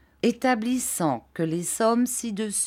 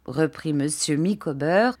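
ordinary delivery, nothing marked

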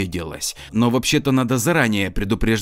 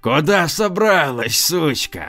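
sinister villain; 7 semitones higher than usual. Acted. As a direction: gloat like a cartoon villain. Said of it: "зловеще прошипел".

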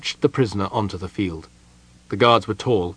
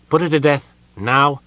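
high, loud demand; urgent command; 6 semitones higher, 7 dB louder than usual. A narrator's reading. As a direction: demand it at once. Uneasy adviser urges execution.

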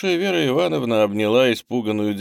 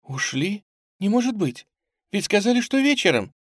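high, startled protest; frightened disbelief; 11 semitones higher than usual. A narrator's reading. Girl learning the steamers have left, frightened. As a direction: question it in scared disbelief. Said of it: "она испуганно вздрогнула".

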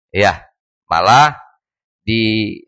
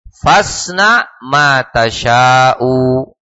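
very slow, drawn-out long vowels; Arabic verse recitation; much slower than usual. A narrator's reading. Teacher quoting a poet to students, slow measured recitation. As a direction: recite the poem deliberately, stretching the syllables.

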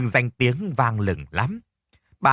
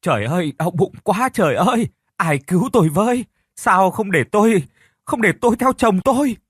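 shrieking in pain, much higher and louder; stomach cramps; 8 semitones higher, 5 dB louder than usual. Acted. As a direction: cry out, doubled over with cramps. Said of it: "Tiếng hét lại vang lên". (la hét vì đau bụng quằn quại)

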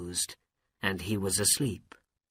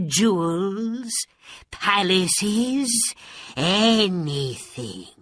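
scheming magician; 3 semitones higher, 4 dB louder, slower than usual. Acted. Magician pressures princess to marry.

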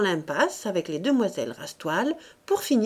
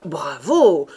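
lower, loud echoing bravo; repeated bravo; 4 semitones lower, 10 dB louder than usual. A narrator's reading. Rastoil echoing the cheer, loud and low. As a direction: echo the cheer in a deeper voice. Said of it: "répéta monsieur Rastoil".

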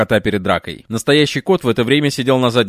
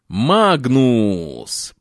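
hissing. Acted. Penelope pinning Magnus in an alley, hissing his name. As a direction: press him to the wall and hiss. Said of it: "зашипела Пенелопа".

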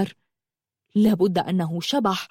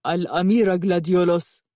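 harsh guard; 2 semitones lower than usual. A narrator's reading. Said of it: "وقال بغلظة".

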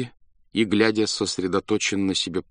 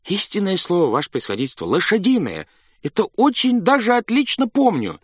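earnest assurance; 12 semitones higher, 4 dB louder than usual. A narrator's reading. Clerk insists eagerly.

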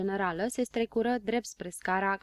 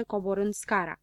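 ordinary delivery, nothing marked

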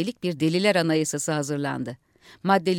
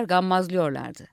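usual speaking voice

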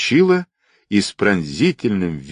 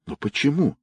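hushed, higher-pitched frightened question; 7 semitones higher, 5 dB quieter than usual. A narrator's reading. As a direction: ask it softly, a little scared. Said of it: "испуганно спросила Настя". (испуганно, тихо)

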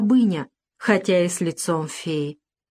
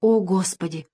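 usual speaking voice

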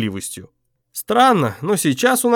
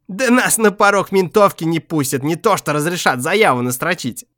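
lightly voiced tough homeless man; a hint of a gruff streetwise bum; rough street guy; 8 semitones higher than usual. A narrator's reading.

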